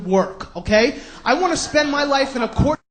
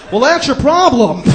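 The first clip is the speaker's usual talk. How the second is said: Doctor Phil; 7 dB louder than usual. Retold or imitated. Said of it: "he's like".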